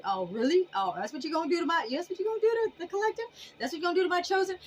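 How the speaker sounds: fast, rising, outraged; mock indignant ancestors. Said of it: "They're like".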